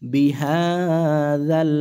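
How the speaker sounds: বিহাযাল stretched very slowly; drawn out. Shown as a demonstration. Teacher models the word for students, very slow. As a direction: draw বিহাযাল out long.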